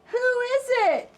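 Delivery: wolf pretending to be grandma; sweetest Granny voice. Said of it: "in his sweetest Granny voice".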